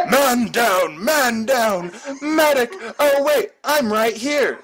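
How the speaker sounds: clown around like a mock medic call; giggly girl calling. A narrator's reading. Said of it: "she giggled".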